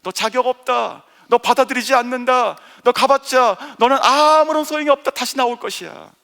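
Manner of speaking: lightly voiced accusing world, loud; a hint of a harsh accuser; preacher voices the world scolding the son. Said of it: "세상이 주는, 우리에게 주는 생각이에요".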